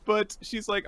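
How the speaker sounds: ordinary talk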